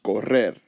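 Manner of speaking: "correr" stressed on the final rer; stress on last syllable. Shown as a demonstration. Teacher showing where voice goes up, "correr" accented at the end. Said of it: "the voice goes up".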